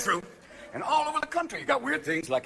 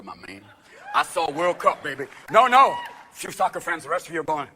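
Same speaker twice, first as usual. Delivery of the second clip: normal talking voice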